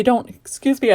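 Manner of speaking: words stretched through a real yawn. Real, not acted. Crafter tired in the evening, yawning mid-sentence. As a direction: talk right through the yawn. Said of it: "I'm yawning".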